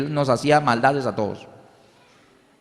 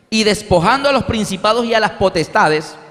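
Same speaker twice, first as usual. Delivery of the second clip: scripture reading; 4 semitones higher, 4 dB louder than usual. Acted. Preacher reads Colossians to the congregation, solemn. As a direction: read the Bible verse with gravity. Verse repeated.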